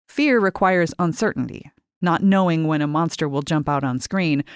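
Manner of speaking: ordinary delivery, nothing marked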